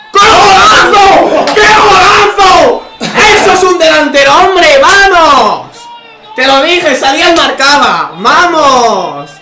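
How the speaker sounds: screaming high and loud, drawn out; goal celebration. Real, not acted. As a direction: roar for the goal.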